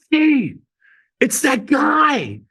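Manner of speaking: high-pitched drawn-out holler, imitated; calling to coworker. Receptionist calls to a coworker behind the muffled phone, mock hollering. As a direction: mimic an excited call across the office. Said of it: "She muffles the phone, but I could still hear".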